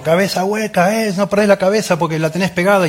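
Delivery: padre que reta al hijo, voz mucho más aguda y lenta; regaño burlón de padre. Retold or imitated. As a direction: burlarse del hijo, voz aguda y fingida. (un padre fingiendo enojo con su hijo, voz aguda exagerada)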